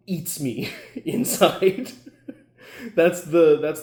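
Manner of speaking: laughing through the words. Real, not acted. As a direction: chuckle it out as you admit it. Laughing while admitting it bothers him.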